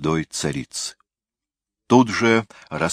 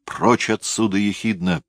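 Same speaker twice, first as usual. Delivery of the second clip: ordinary delivery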